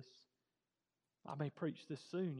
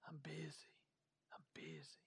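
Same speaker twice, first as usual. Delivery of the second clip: mock whisper; 13 dB quieter than usual. Mocking the busy excuse.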